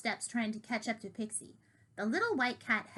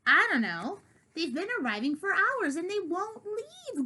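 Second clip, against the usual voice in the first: louder growled complaint; growling; 8 semitones higher, 4 dB louder than usual. Acted. Diggory growling about the visiting cats, louder. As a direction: growl it, higher on hours. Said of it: "growled Diggory".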